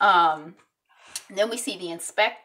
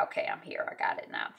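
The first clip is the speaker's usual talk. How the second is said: soft whisper to herself; whisper to self; 7 dB quieter, faster than usual. Real, not acted. Narrator catching up on the plot, a soft whisper. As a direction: whisper it, just for you.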